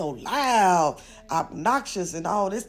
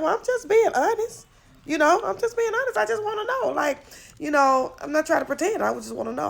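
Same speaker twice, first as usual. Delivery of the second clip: high, prying mock voice of a nosy woman; sing-song imitation of a meddling woman; imitating her; 10 semitones higher than usual. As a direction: pitch it way up and pry like her. Retelling her defending herself.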